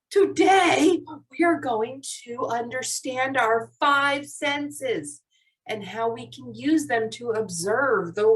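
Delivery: slow, deliberate teacher explanation; slowed for emphasis. Shown as a demonstration. Teacher tells class the important goal, slowly and emphatically. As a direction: punch the opening word, then stretch the key phrases. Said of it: "slow down on the super important parts".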